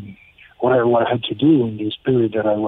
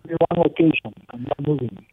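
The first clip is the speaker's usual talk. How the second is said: garbled underwater phone line; bad connection, sounds underwater; 4 semitones higher than usual. Real, not acted. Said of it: "It sounds like you're underwater".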